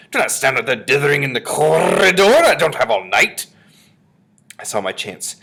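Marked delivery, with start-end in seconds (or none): 0.1-3.5 loud, high, imperious witch; exasperated witch shouting orders; snapping. A narrator's reading. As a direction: bellow impatiently at dawdlers. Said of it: "snapped the Grand High Witch".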